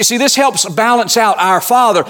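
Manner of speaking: usual speaking voice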